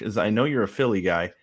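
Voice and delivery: ordinary talk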